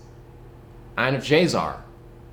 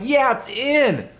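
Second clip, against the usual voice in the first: friends' retold chorus; 12 semitones higher, slower than usual. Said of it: "all the friends were like".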